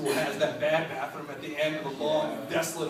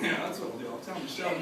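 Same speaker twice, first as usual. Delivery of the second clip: timid agreement; 3 semitones lower, 7 dB quieter, faster than usual. Retold or imitated. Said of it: "I was like".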